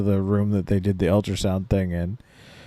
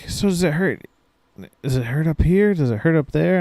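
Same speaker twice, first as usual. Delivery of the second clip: lightly pitched-up doctor asking; mock doctor questions; 6 semitones higher than usual. Patient imitating the doctor probing her leg, lightly higher. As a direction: imitate a gentle doctor, voice raised a little.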